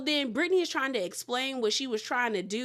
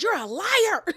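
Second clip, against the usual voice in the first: mock accusing; 9 semitones higher, 5 dB louder, slower than usual. Acting Meredith's accusation.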